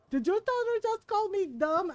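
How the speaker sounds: offended uncle. Retold or imitated. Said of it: "He goes".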